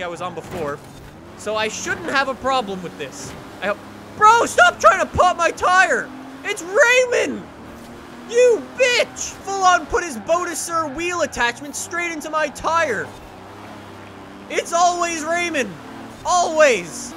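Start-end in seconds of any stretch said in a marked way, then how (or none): 4.2-15.7 high, loud indignant shouting; angry outburst. Real, not acted. Player rammed by Raymond, angry outburst. Rammed by rival driver in race. 16.3-17.1 exasperated. Real, not acted. Complains about same rival driver.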